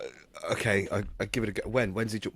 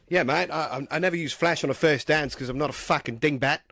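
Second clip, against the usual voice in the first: higher, louder hammed-up Australian; fake Australian accent; 5 semitones higher, 3 dB louder than usual. Host proves he is Josh to the guest, in a hammed-up Australian accent. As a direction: swagger it out in a bad Australian accent. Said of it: "anything the real Josh Huggett would say".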